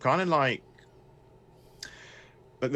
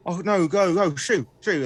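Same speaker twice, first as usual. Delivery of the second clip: higher, louder flustered shoo; frantic shooing; 9 semitones higher, 7 dB louder than usual. Acted. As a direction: flap the rats away in a panic. Man shooing the rats.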